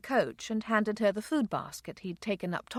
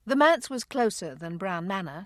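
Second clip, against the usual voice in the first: ordinary delivery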